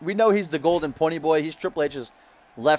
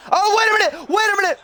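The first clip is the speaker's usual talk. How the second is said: excited shout; 14 semitones higher than usual. Real, not acted. Commentator spotting a surprise entrance, shouting excitedly high. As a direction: yell it as the run-in happens. Surprise run-in during the match.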